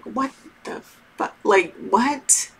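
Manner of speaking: breathless whisper, baffled; whispered disbelief. Retold or imitated. To her friend on the phone, a whispered baffled reaction. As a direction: whisper it like you can't believe the request. Reacting to odd advice again.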